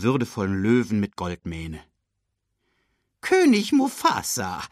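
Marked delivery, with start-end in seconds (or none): 3.2-4.6 high-pitched, breathless-with-news announcement; squawky high bird voice, all worked up; excited bird messenger. A narrator's reading. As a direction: report to the king in great excitement. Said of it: "berichtete er aufgeregt".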